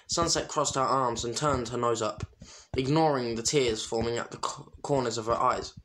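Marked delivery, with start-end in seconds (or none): none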